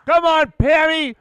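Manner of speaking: loud, high play-by-play yelling; stoked shouting. Real, not acted. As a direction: shout the call in pure excitement. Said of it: "I'm too stoked".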